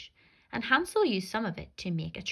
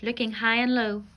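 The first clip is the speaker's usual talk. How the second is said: ordinary delivery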